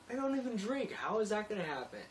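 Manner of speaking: high, loud incredulous reply. Retold or imitated. Narrator protesting to the iron worker, high and incredulous. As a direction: squeak it in disbelief. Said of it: "I'm like".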